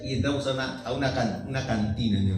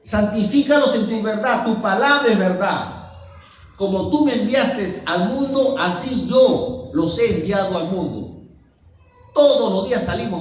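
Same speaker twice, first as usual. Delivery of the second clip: loud, raised preaching voice; forceful preaching; 6 semitones higher, 8 dB louder than usual. Acted. Preacher reciting scripture to the brethren, forceful preaching. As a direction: proclaim the verse to the congregation.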